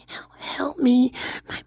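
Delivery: breathy whisper of a trapped ghost; a ghost pleading in a whisper; ghostly whisper. Pretend. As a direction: whisper like a spirit begging for help. Trapped ghost character speaking.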